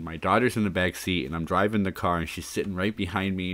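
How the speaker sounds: ordinary talk, nothing marked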